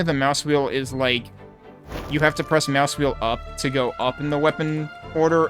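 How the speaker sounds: usual speaking voice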